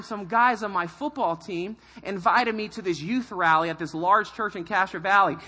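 ordinary talk

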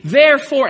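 preacher driving the point home; emphatic therefore. Acted. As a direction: hammer the word "Therefore". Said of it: "repeated therefore emphasis".

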